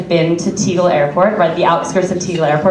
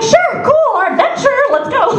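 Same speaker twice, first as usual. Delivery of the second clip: fake cheerful. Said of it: "But I'm just like".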